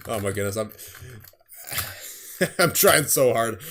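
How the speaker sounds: usual speaking voice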